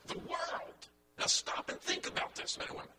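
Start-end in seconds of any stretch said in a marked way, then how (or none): 0.1-0.9 THE WORLD set apart in a hushed, near-whispered voice; underscored WORLD. Real, not acted. Preacher underscoring whom his listeners influence, THE WORLD softly set apart. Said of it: "I'm gonna underscore this".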